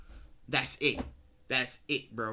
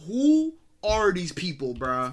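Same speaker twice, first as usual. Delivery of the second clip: incredulous; 3 semitones higher, 5 dB louder, slower than usual. Real, not acted. Shocked at new characters.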